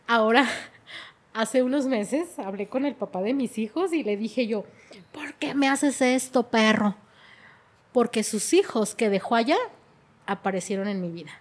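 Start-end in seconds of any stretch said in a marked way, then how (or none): 5.1-6.9 slow, drawn-out accusation rising into a loud insult; imitating a bitter confrontation; retold angry reproach. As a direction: re-enact confronting him, hard on the last word. Confronts children's father, quoted.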